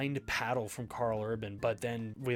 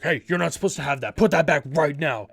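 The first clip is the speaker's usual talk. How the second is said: loud, fast mock scolding; imitated yelling guard; 12 dB louder than usual. Security guy yelling at a fan to put the paddle back, loud mock scolding. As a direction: bark it like an angry security guy. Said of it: "freaking out and yelling at me".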